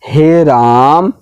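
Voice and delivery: हे राम called out, vowel held very long; prolonged call. Shown as a demonstration. Teacher showing the longest vowel with हे राम. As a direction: stretch हे राम like a drawn-out cry. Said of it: "ज़्यादा समय लग रहा है सबसे".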